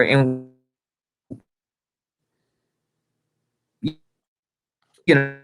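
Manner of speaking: choppy connection dropping words; corrupted glitchy audio. Real, not acted. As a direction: voice breaking up over a bad line. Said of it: "Your voice glitching out".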